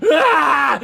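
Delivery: high, loud scream of frustration; frustrated scream. Acted. Game master as a villain whose bolt misses, frustrated scream. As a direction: scream as your shot misses everyone.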